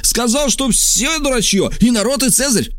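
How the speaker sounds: high, cursing cry; shouting accusation. A narrator's reading. Stranger denounces Trasul to the lictors, high angry shout. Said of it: "крикнул незнакомец".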